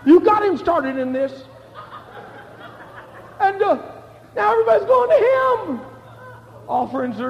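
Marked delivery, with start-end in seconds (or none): 0.0-5.8 preaching at full force. Acted. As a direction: drive the story up to the crowd. Preacher retelling John's story.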